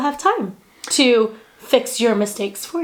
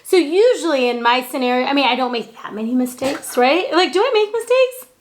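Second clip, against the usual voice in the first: usual speaking voice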